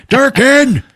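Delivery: high, loud, drawn-out name; imitated impatient holler; exasperated interrupting yell. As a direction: cut off a rambler by shouting. Interrupting rambling Durkin with shout.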